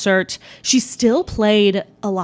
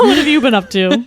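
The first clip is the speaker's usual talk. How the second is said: higher, louder, through real laughter; laughing question; 4 semitones higher, 6 dB louder than usual. Real, not acted. Host asking co-host after joking, laughing. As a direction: ask it while cracking up.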